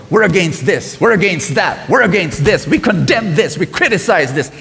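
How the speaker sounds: loud, scornful list of condemnations; mock judgmental church. Preacher mocking a condemning church, loud and scornful. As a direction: sneer at a church known for what it opposes.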